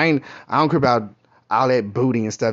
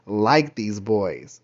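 slow dismay; 3 semitones lower, much slower than usual. Retold or imitated. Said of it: "I was like".

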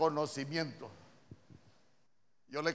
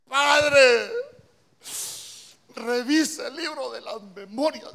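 loud, high cry of a pleading king; desperate plea; 10 semitones higher, 14 dB louder, slower than usual. Acted. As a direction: cry out to God, begging for more time. King pleads with God in story.

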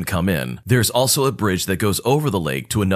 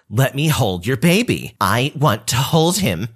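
entitled cousin; 2 semitones higher than usual. Retold or imitated. Cousin repeats her demand.

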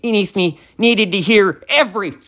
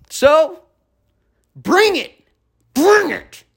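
taunting dare; 4 semitones higher, slower than usual. Real, not acted. Daring the neighbor to fight.

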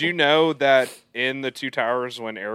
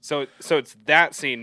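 ordinary talk, nothing marked

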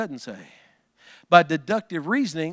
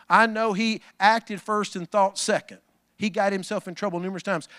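ordinary delivery

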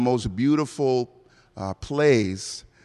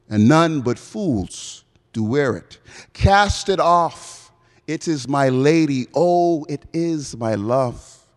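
slow, theatrical Romeo with drawn-out words; stage verse, stretched out with big swoops in pitch; dramatic Shakespeare recital; slower than usual. Acted. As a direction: declaim it as a lovestruck Romeo, swelling and falling. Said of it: "try my best in a semi-Bahamian American accent".

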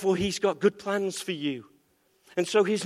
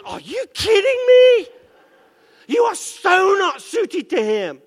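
a much higher, louder incredulous shout; God incredulous; 12 semitones higher, 8 dB louder, slower than usual. Acted. The Lord rejects the prayer for the muscle man with a shout. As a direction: shout it at the foolish request in disbelief. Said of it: "the Lord's saying".

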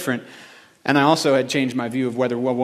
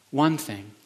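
softer repeat; soft emphasis; 5 dB quieter than usual. Real, not acted. Preacher repeating his theme, softer. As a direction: let it land quietly. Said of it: "repeated softly".

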